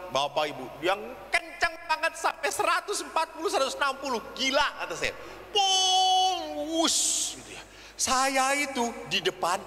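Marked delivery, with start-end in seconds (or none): 5.5-7.4 high drawn-out zoom into a whoosh; speeding car noise. Retold or imitated. Priest imitating a speeding car on the toll road, whooshing. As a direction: imitate a car shooting past.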